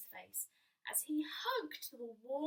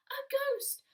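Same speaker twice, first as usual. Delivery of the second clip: "A ghost!" cried out much higher and louder; frightened cry; 12 semitones higher, 8 dB louder than usual. Acted. Little Bear crying out in fright at the sheet. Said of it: "he cried".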